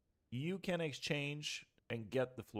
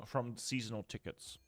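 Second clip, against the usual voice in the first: normal talking voice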